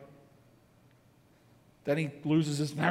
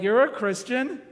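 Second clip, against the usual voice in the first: incredulous; 7 semitones higher, 4 dB louder than usual. Retold or imitated. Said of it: "people who knew you way back then are like".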